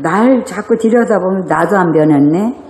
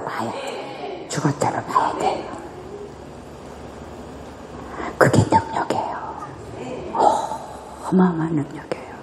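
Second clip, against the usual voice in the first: whisper; 3 semitones lower, 12 dB quieter than usual. Real, not acted. Preacher drops to intense whisper.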